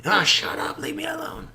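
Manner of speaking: high whispered snarl; whispered mock drunk telling him to shut up; drunk brushing off. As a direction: hiss it away like a grumpy drunk. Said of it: "drunk man rebuffs old man".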